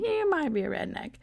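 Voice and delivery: high, punchy comedian's catchphrase; punchline catchphrase. Retold or imitated. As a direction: land it like a stand-up tag line.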